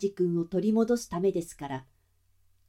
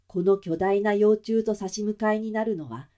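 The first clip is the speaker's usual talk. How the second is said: ordinary delivery, nothing marked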